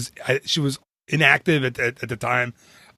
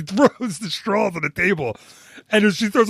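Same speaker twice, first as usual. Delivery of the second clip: ordinary talk, nothing marked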